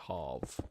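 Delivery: "half" with a long ah vowel; Pommy pronunciation. Shown as a demonstration. Streamer telling chat own way, "half" with long ah. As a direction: say "half" as hahf. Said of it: "the Pommy way".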